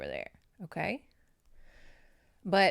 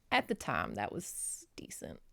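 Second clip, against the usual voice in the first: much softer, lower whisper, slowly; whispered aside; 7 semitones lower, 8 dB quieter than usual. Real, not acted. To viewers, about the age gap back then, whispered. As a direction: drop it to a conspiratorial whisper.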